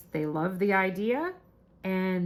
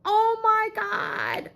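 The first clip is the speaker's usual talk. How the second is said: long, high-pitched squeal of disbelief; slow, high freak-out; 14 semitones higher, much slower than usual. Retold or imitated. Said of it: "I was freaking out".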